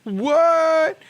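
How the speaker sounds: usual speaking voice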